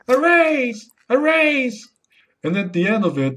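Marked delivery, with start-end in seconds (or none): 0.1-1.9 high-pitched parrot call; squawking parrot. Acted. Parrot interrupts a performance review from the bag, squawking parrot. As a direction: squawk it like a parrot, much higher. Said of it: "and the parrot goes".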